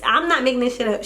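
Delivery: normal talking voice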